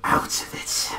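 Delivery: drawn-out, breathy whisper; shaking deep breath. Acted. Reviewer acting out Shigaraki shaking before he snaps, slow breathy whisper. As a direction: whisper it very slowly on a heavy breath. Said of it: "it's like, deep breath".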